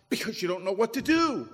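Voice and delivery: ordinary talk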